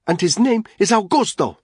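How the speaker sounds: high, loud defiant woman; defiant mother. Acted. Mother correcting the thug at her door, high and loud. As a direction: fling it back over her shoulder.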